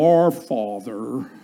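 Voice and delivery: low, slow, put-on pious tone; mock the preacher who goes deep to pray; holy prayer voice. Pastor mocking a showy prayer voice, low and slow. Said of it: "they change their voice when they pray".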